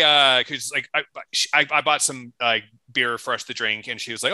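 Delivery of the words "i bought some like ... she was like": ordinary talk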